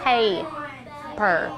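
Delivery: "Paper" as pay, pause, per; teacher's drawn-out two-beat "Paper"; syllable by syllable. Shown as a demonstration. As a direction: leave a clear gap inside "Paper". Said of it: "How many syllables?".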